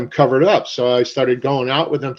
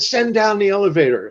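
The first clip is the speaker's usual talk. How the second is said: calling up; 7 semitones higher, 4 dB louder than usual. Retold or imitated. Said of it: "started yelling".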